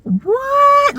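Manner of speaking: high stunned what; shocked what. Retold or imitated. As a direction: pitch leaps way up in disbelief. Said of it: "we were like".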